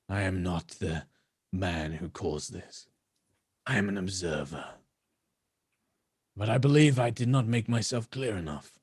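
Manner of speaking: lightly voiced rasping elf; low cold warning from a stranger.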